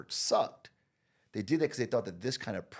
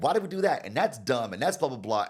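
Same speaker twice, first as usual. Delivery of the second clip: much higher, louder mocking complaints; scathing critics; 5 semitones higher, 7 dB louder than usual. Facebook team tearing the work apart, high loud scoffing. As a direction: pile on the work like harsh critics.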